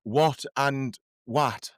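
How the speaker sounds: slow "what" with rounded o, then "what" with short flat a; very slow demo of two vowels in "what and what"; contrasting what pronunciations. Shown as a demonstration. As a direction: set "what" as "wot" against "what" as "wat". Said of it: "So if I said".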